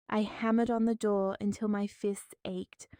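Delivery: ordinary delivery, nothing marked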